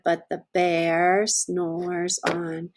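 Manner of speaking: slow, drawn-out storybook refrain. Acted. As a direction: let the refrain linger slowly. Refrain read to children.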